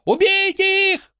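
slow, very high squealed order; wailing yell. A narrator's reading. Frankie ordering onlookers to kill, drawn-out high wail. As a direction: stretch the squeal out. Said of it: "завопила Фрэнки".

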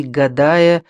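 ordinary delivery

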